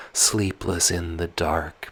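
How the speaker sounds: usual speaking voice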